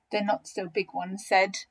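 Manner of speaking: plain reading voice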